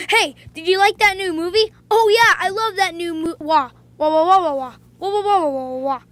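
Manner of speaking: silly wah wah gibberish; mock the noise humans make; babbling wah voice. Imitating humans as cats hear.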